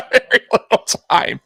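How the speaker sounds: can't hold back the laugh. Real, not acted. Repeating the clock joke to viewers, laughing.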